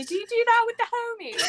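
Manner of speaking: imitating Ariel's accent. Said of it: "hear Ariel's in an accent say".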